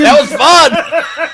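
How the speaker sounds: slow, drawn-out, delighted mock voice with a laugh; gleeful armless Florida man. Friends joke, voicing the reckless Florida man, slow and gleeful. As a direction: drag it out like you loved losing the arm.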